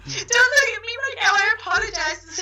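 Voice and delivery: high, loud fake sobbing apology; hammed-up tearful baker begging; mock crying baker. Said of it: "they'll apologize if they do cry".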